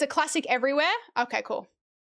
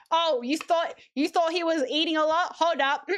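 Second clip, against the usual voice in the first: usual speaking voice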